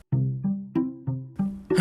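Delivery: long exhaled sigh; exasperated breath out; weary sigh. Real, not acted. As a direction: let out a big sigh before starting. Sighs before discussing the Spy class.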